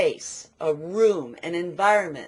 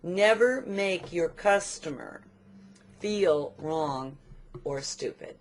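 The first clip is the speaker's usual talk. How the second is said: slow dictation; 3 dB quieter, slower than usual. Real, not acted. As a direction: dictate it in measured pieces, sinking softer. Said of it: "So write this down".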